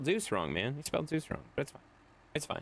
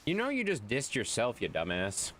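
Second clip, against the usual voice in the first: usual speaking voice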